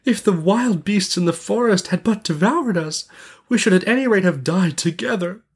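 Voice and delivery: put-on high, plaintive little-girl lament; lamenting cry. A narrator's reading. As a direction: wail it lightly as a despairing girl. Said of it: "she cried".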